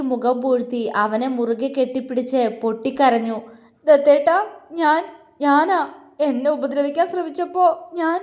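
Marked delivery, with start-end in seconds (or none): 3.9-8.2 higher, broken and halting through tears; sobbing babble. A narrator's reading. Heroine sobbing into her lover's chest, high and broken. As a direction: blurt it out between sobs. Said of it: "അവൾ പൊട്ടിക്കരഞ്ഞുകൊണ്ട് പുലമ്പി".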